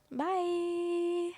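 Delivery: high, drawn-out "bye"; singsong goodbye. Real, not acted. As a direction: hold a high, light note on the farewell. Singsong sign-off to viewers.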